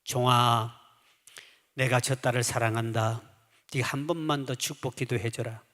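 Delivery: a hint of a solemn heavenly voice; voice of God. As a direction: speak as God calmly instructing his servant. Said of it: "주의 음성이 그때 들려왔습니다".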